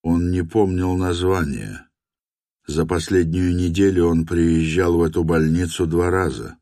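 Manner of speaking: usual speaking voice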